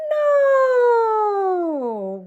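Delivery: No starts very high and loud, sliding down; falling high cry. Shown as a demonstration. Teacher asks kids which way the voice went, high and falling. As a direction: let the No drop from the top. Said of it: "We went down".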